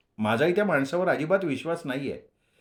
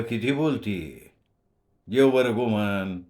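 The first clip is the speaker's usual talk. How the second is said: lower, slow gruff father; a hint of a stern old father; lightly voiced father; 3 semitones lower, slower than usual. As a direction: settle the children down slowly. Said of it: "वडिलांच्या बोलण्याने".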